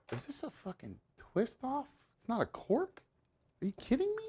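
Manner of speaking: rising pitch in disbelief; can't believe it's a twist-off; incredulous. Real, not acted. Finds a screw cap, disbelief.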